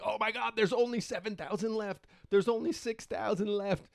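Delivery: high frantic Discord crowd; panicked crowd. Retold or imitated. Discord users panicking over the dwindling supply, high and frantic. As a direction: mimic the panicking buyers. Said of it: "thousands of people like".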